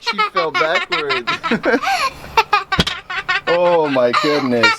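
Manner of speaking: high, slow laughing after a fright; jump scare laugh. Real, not acted. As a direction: laugh off the shock. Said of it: "you scared me".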